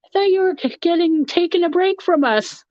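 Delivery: high, playful mock accusation; playful teasing, pitched well up; teasing chat quote. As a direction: tease her for skipping the break. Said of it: "I went in your chat and be like".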